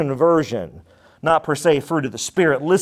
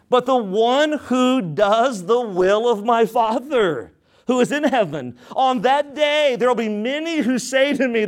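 raised, measured preaching of the verse; scripture reading; 6 semitones higher than usual. Acted. Pastor reading scripture to the church, raised and measured. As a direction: read the Word out to the congregation.

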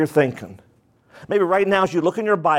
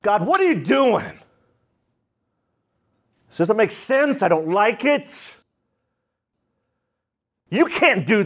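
higher, accusing questions at God; prideful demanding; 5 semitones higher than usual. Shown as a demonstration. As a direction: demand answers like you're owed them. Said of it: "the pridefulness is".

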